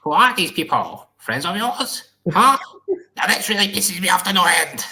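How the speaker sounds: high, put-on villain voice; hammed-up old film villain, pitched well above normal; David Lopan. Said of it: "David Lopan".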